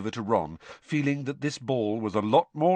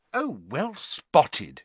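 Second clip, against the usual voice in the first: slow, higher, acid sarcasm; acidly; 7 semitones higher, much slower than usual. Acted. Hermione to Ron noticing she's a girl, acidly. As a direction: draw it out with biting sarcasm. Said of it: "she said acidly".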